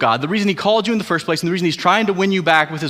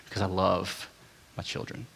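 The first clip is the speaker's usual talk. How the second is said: soft, quiet fatherly vow; 5 semitones lower, 11 dB quieter than usual. Retold or imitated. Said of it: "He said".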